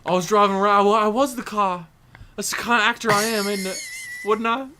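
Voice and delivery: a Cockney voice that keeps slipping; Michael Caine impression. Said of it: "This accent is all over the place".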